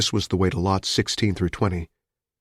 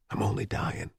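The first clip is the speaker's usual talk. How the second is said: quiet; 9 dB quieter than usual. Acted. Wounded man jokes to Amelia.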